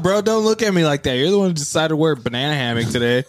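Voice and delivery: ordinary talk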